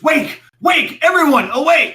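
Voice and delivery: very high, loud rousing shouts; urgent, repeated alarm cries; yelling to companions. Acted. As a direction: yell to wake the whole camp. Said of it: "he starts yelling to his".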